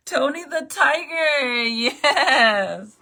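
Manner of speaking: loud laughing answer. Real, not acted. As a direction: laugh out the name. Laughing at her look.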